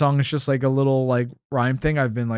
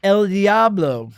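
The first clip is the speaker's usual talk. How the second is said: drawn-out, higher Spanish cry; mock devil announcement; 5 semitones higher, 3 dB louder, much slower than usual. Playing along with the scared neighbor joke, mock devil announcement. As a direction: announce the devil with long, held vowels.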